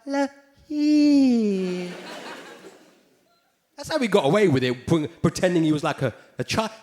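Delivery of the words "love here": [name] voice. Retold or imitated. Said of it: "with that high".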